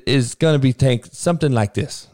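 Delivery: ordinary delivery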